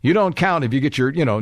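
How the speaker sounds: ordinary delivery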